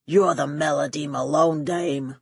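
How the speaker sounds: lightly voiced gangster growl; growled thug line. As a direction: growl it like a hired heavy. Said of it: "one of them growled".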